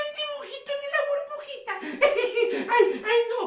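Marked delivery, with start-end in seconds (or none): none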